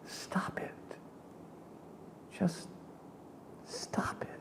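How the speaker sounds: unvoiced whisper, stop it; whispered self rebuke. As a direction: hiss it at yourself. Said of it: "and I thought".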